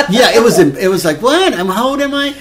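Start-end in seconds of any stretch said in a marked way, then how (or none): 1.2-2.3 high-pitched incredulous retort; mock-indignant, voice shooting up; indignant surprise. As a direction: squeak it out in disbelief. Mock reaction to being shushed.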